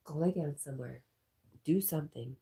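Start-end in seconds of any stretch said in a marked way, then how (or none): none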